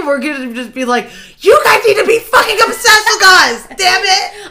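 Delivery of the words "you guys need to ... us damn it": shouting. Pretend. Mock demand to be obsessed.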